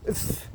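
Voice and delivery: f as a bare, quiet puff of air; isolated F sound. Shown as a demonstration. Teacher demonstrating the F rune, bare sound. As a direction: hold a voiceless f on its own. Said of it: "is the sound of the F rune".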